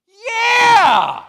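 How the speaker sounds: very high, full-force shout; screaming. Retold or imitated. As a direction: scream it like an excited little boy. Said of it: "he just starts screaming".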